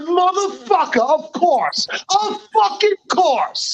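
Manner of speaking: high, drawn-out exasperated curse; resigned panic. Retold or imitated. Friend on the falling elevator, high exasperated curse. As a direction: squeal it out like doom is certain. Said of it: "I'm going".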